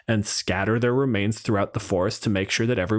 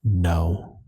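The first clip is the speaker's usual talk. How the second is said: low, close hushed warning to the party; treant voice; 6 semitones lower, much slower than usual. Retold or imitated. Treant leaning into a lumberjack's face, low hushed warning. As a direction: lean in and warn him off, low. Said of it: "leans down in his face and goes".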